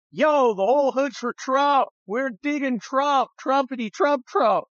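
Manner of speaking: high, hyped-up amateur rapper imitation; mock rapper. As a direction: chant it like a fourth-tier rapper. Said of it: "fourth tier rappers".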